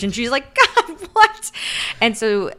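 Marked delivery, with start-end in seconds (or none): 0.5-1.5 very high, loud disbelief; hammed-up astonished reaction; mock shock. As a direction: squawk it in put-on shock. Shocked by friend's confession.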